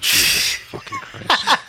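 very high, slow squeaky groan; mock exasperation. Real, not acted. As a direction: drag it out in fake despair. Exasperated joke reaction.

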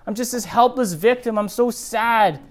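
high, loud, whiny mock self-pity; mock Esau as a whining victim; hammed-up sulky whine to Dad; mock helpless victim. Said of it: "So here he's saying".

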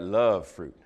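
low, playful love fruit; suggestive tease. Real, not acted. Pastor teasing the congregation, low and suggestive. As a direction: say it with a wink.

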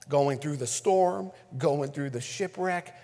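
ordinary talk, nothing marked

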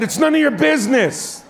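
high-pitched mock outburst; exasperated retort. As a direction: blurt it out at the nagging wife. Retold outburst at wife.

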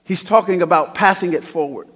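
ordinary talk

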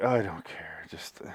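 unvoiced, flat whisper. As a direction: breathe it out with no voice. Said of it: "I was like".

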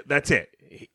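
ordinary delivery

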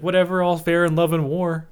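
high, airy brush-off; dismissive girl voice. Retold or imitated. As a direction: toss it off like a mean girl. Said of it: "And she's like".

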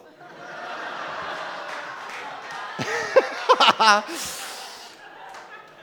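long breathy laugh at his own slip; burst of laughter. Real, not acted. Preacher laughs at his own slip, real laughter. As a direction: laugh it off, caught out.

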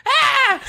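high shrieking scream with a laugh; horrified scream. Retold or imitated. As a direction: shriek in horror. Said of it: "the first thing he just goes".

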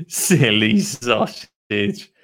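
very slow, stretched-out "Silly sausage"; drawn-out teasing. Acted. Lottie teases pot-headed Dottie, very slow drawn-out delivery. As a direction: drag out both words of "Silly sausage". First take, greatly drawn out.

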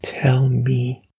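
breathy, whispered whimper. A narrator's reading. Girl begging her father for answers, whispered whimper. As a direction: whimper it faintly under your breath. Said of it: "Nikki whimpered".

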